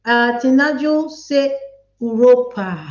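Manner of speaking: normal talking voice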